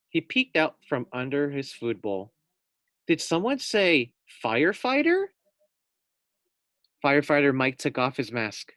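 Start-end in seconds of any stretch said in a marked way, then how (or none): 3.1-5.3 curious surprise. Acted. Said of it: "thought Fluffy".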